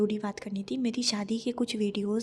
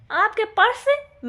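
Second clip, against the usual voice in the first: much higher and louder, slow, stretched purse; outraged disbelief; 12 semitones higher, 10 dB louder, slower than usual. Real, not acted. Wife denying she touched husband's purse, outraged and slow. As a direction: drag out the question, offended.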